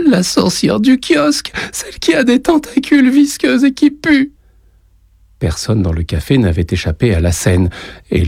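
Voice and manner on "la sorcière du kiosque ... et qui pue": put-on very high, squeaky child's voice; whiny, upset child; tearful little boy. A narrator's reading. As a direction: describe the monster as a scared small boy. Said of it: "les larmes aux yeux".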